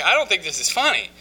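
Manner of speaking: high, loud mock indignation; offended. Newcomer objects to his counselor laughing at him, indignant retold protest. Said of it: "really kind of offended by it".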